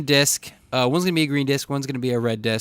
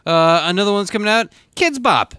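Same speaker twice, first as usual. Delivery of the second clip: put-on high, loud kids' album hype; mock ad pitch; 5 semitones higher, 4 dB louder than usual. Host selling a Kidz Bop Christmas album, mock ad pitch. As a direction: pitch it like an over-excited announcer, mock-cheery.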